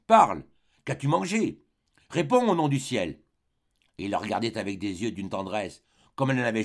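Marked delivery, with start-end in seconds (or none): 0.1-3.2 desperate plea. Acted. Husband begging dying wife.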